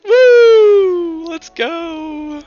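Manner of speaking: high, loud whoop; drawn-out hype shout for a first kill; excited cheer. Real, not acted.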